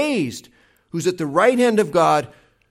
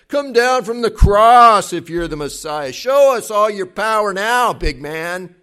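louder, higher jeering challenge; taunting; 5 semitones higher, 8 dB louder than usual. Retold or imitated. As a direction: jeer up at the man on the cross. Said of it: "They were taunting him".

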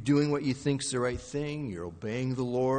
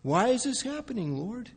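betrayed plea; 10 semitones higher than usual. Acted. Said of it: "you feel a little bit betrayed".

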